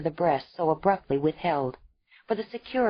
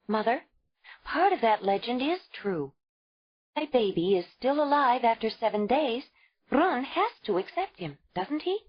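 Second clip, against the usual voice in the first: higher, hopeful; earnest young mother; 7 semitones higher than usual. A narrator's reading. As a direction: ask hoping for yes. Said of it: "Ayla asked earnestly".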